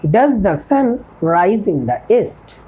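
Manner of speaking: each word spaced out for learners; a teacher dictating an English model sentence, slow and clear; slow dictation. Shown as a demonstration. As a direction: spell it out slowly like a teacher at the board. Teacher slowly dictates model question.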